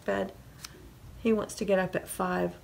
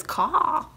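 high single caw; crow caw; 7 semitones higher than usual. A narrator's reading. Crow joining the farmyard wake-up, cawing. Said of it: "the crow says".

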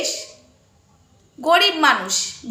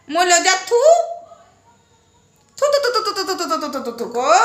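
mock spitting; 2 semitones higher than usual. Mock spitting at rival, laughing.

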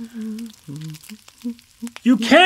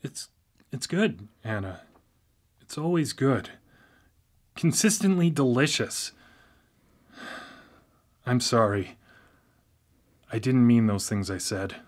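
much softer, lower contrite voice; subdued apology; 10 semitones lower, 10 dB quieter than usual. Acted. Brother apologizing to his sister over dinner, subdued and soft. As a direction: back down and make peace.

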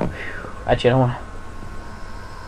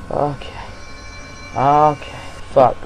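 ordinary talk

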